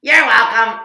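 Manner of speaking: loud, bright-spirited farewell call; called out loudly. Real, not acted. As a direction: call it out to the departing helper. Calling goodbye to child helper.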